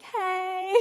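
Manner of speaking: high, soft drawn-out hello; mock sweet greeting. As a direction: greet coyly, as if nothing were wrong. Acts couple FaceTiming the ex.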